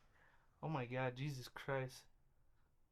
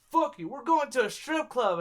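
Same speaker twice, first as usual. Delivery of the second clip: higher, louder retold group cheer; imitating hyped-up friends; rowdy announcement; 6 semitones higher, 7 dB louder than usual. Retold friends announcing strip club plan.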